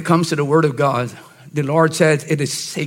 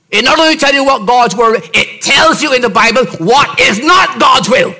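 higher, louder sermon voice; emphatic preaching; 6 semitones higher, 4 dB louder than usual. Acted. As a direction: drive the not home. Preacher stressing doctrine.

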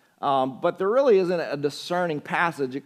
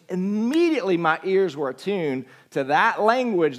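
louder, driving preacher's voice; a louder sermon voice recalling an amazing moment; fervent preaching; 4 semitones higher, 6 dB louder than usual. Acted. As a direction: preach it to the congregation with rising passion. Preaching with rising force.

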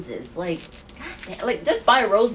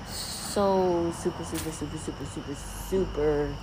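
jittery; 3 semitones lower, 7 dB quieter, slower than usual. Real, not acted. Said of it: "did I have too much coffee today".

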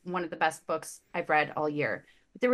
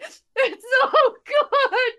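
far higher, louder hammed-up crying voice; mock sobbing; 9 dB louder, slower than usual. Wife sobbing over the book's ending, mock crying. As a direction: wail it through mock tears. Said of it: "I'm like".

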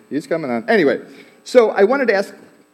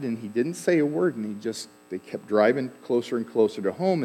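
usual speaking voice

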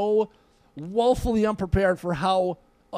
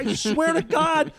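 high-pitched exasperated insistence; animated, pissed off; 9 semitones higher than usual. Retold or imitated. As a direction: protest wildly to people who doubt you. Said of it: "so animated and so pissed off".

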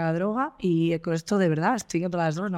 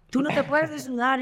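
mock cop reprimand; 7 semitones higher than usual. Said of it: "el tío me gritaba".

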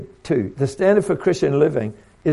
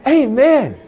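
high ringing amen; loud joyful amen; 10 semitones higher, 9 dB louder than usual. Retold or imitated. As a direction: answer it with delight. Joyful response to praise.

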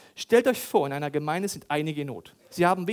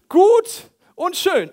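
slow, high, loud, mocking drawl; sarcastic drawn-out concession; 13 semitones higher, 13 dB louder, much slower than usual. Preacher mocking believers with a sarcastic concession. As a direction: stretch it out with sarcasm.

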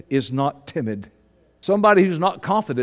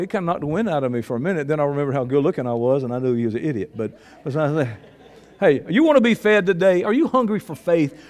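ordinary talk, nothing marked

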